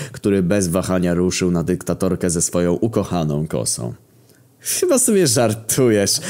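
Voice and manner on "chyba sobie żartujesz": slow, higher, scornful scoff; scoffing snort. A narrator's reading. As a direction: laugh him off with contempt. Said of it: "parsknęła Maryja".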